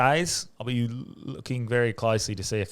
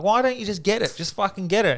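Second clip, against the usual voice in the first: mock nagging; 10 semitones higher, 4 dB louder than usual. Family nagging at barbecue.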